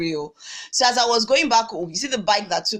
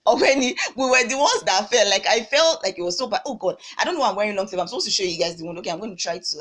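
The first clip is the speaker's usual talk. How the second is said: ordinary talk, nothing marked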